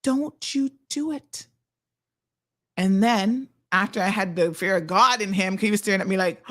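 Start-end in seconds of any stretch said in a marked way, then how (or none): 0.0-1.4 slow, hushed mock warning; stern warning. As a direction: imitate warning a child, drawn out. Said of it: "And I was like".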